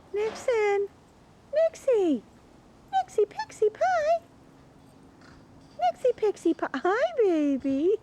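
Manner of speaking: high, loud sing-song call; calling the dogs. Real, not acted. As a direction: sing their names out high and bright. Calling her dogs over.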